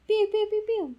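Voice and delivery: high squeaky zaps; high laser pew. Pretend. Sound effects.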